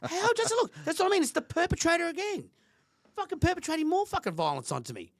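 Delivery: high, loud and indignant; worked-up indignation. Real, not acted. Father ranting about being cast as perpetrator, indignant and high. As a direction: let the outrage climb high. Indignant rant, much higher.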